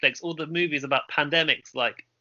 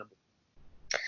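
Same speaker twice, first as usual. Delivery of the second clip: breathy hushed whisper; 14 dB quieter, much slower than usual. Real, not acted. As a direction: drop to a secret whisper. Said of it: "Why are you whispering that?".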